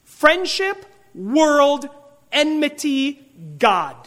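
Friendship punched high, then world, enmity, God each set apart; stress on the four words. Shown as a demonstration. Preacher drives home four words to the congregation, stressed one by one. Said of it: "all the emphasis is really on four words".

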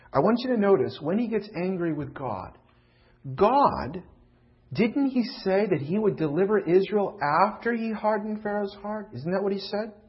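usual speaking voice

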